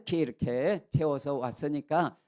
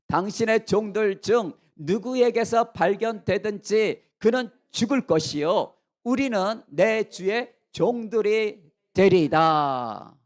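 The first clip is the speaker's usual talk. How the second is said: raised, emphatic declaration; confident loud vow; 4 semitones higher, 5 dB louder than usual. Acted. Accused brothers staking their lives, loud confident vow. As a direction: declare it boldly, slowing into a drawn-out ending. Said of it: "그들은 큰 소리를 칩니다".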